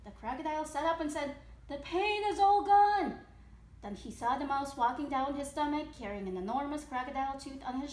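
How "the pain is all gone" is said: loud, high, drawn-out exclamation; joyful relief. Acted. Crocodile sits up after toothache ends, joyfully exclaiming. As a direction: proclaim it slowly and triumphantly.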